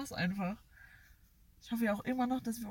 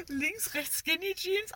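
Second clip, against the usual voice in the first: imitating boys; 6 semitones higher, slower than usual. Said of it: "die zwei Jungs gequotet".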